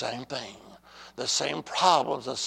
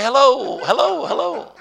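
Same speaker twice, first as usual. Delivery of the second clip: high, loud repeated hellos; insistent phone hello; 7 semitones higher, 6 dB louder than usual. Retold or imitated. Preacher calls into the telephone, high and insistent. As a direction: call down the line, higher each time. Acting out answering the phone again.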